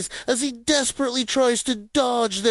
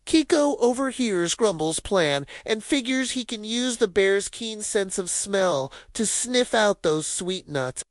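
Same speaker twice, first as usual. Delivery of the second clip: usual speaking voice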